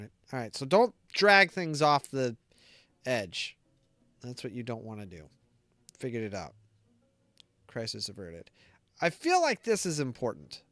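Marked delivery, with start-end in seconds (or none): none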